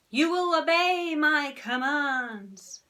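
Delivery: slow, hammed-up hypnotist drone. Henry hams up hypnotising Ralph, slow. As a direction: stretch each word to put him under. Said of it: "Henry, the hypnotist".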